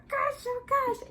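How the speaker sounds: very high, excited squeal; squealing disbelief. Retold or imitated. Mother reliving the birth, high excited squeal. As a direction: squeal it like you can't believe it.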